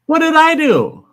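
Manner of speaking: high, loud startled cry; high confused yelp of protest. Retold or imitated. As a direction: blurt it out, baffled and alarmed. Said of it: "I go".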